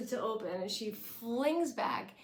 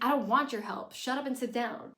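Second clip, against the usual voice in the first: loud, sharp brush-off; angry Paris; 3 semitones higher, 6 dB louder than usual. Retold or imitated. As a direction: cut her off in anger. Paris snaps at Rory.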